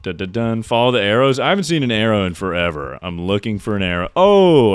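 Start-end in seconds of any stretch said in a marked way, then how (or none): 4.2-4.7 sudden high loud oh; surprised gasp. Real, not acted. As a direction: react in surprise. Spots something odd in game.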